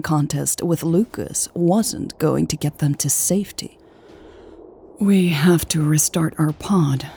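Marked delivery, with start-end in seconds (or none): none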